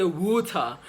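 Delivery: British "water" with no rolled R; non-rhotic British "water"; British accent. Shown as a demonstration. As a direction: say "water" like a Brit, dropping the R. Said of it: "The Brits are more likely to say".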